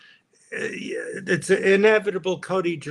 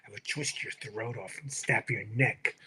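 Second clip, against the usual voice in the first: Baldwin threat; 6 semitones lower, 9 dB quieter than usual. Retold or imitated. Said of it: "he goes".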